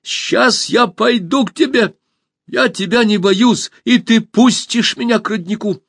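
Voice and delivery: high, loud and slow; bold shout. A narrator's reading. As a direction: declare it fearlessly. Alisa boldly calls out.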